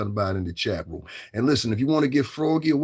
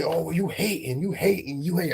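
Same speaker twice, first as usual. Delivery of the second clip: mock accuser; 5 semitones higher than usual. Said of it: "some people gonna say".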